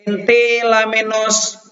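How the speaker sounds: slow, high, solemn reading of Greek text; slow Greek reading practice. Shown as a demonstration. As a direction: read aloud slowly, each word clear. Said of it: "Ya, latihan baca". (guru membaca teks Yunani dengan lambat dan khidmat)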